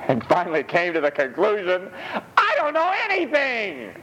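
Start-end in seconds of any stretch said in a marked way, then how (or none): 2.4-3.9 mock the helpless philosopher. Preacher mocks Descartes' conclusion, high and silly.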